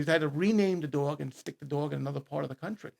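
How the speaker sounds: usual speaking voice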